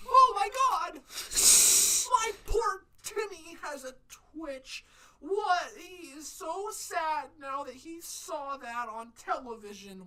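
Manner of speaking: high, slow whiny mock mother; hammed-up high-pitched fretting parent; outraged mom. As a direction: wail about poor Timmy like an overprotective mom. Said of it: "today's society would just fucking lose their shit".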